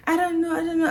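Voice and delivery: high-pitched tearful imitation; mock crying. Crying victim answering the nurse, mock-tearful. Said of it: "I was just crying".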